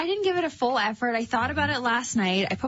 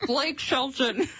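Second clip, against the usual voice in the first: slow, muffled by a stuffed mouth; name forced through full cheeks; slower than usual. Real, not acted. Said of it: "That was clear as day".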